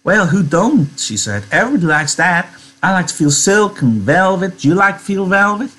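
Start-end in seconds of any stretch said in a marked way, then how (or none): 0.0-1.0 higher-pitched, lilting agreement; lightly voiced Curly's wife. Curly's wife agreeing with Lenny, higher and chatty. 1.5-5.7 high, lilting folksy voice; chatty Curly's wife. Acted. Curly's wife asking Lenny about velvet, high and chatty.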